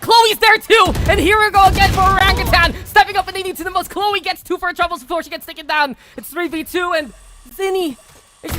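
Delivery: loud, high hype call; excited shouting. Real, not acted. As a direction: shout it like a clutch play. Caster hypes clutch round.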